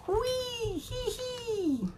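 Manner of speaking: a little pea giggling with glee, way up high; high gleeful squeal. A narrator's reading. Pea headed for the pot, giddy high squeal.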